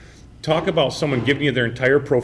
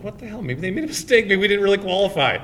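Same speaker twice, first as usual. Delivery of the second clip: baffled; 9 semitones higher than usual. Retold or imitated. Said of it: "I'm like".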